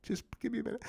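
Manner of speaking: tiny high tearful squeak; faked weepy near-whisper; mock choked up. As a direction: pretend to be too overcome to go on. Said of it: "I really might cry".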